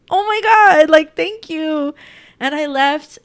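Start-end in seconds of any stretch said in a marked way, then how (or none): 0.1-1.9 high, loud burst of gratitude; reenacted grateful exclamation; overwhelmed thanks. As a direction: gush it, stretching out the thanks. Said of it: "And I was just like".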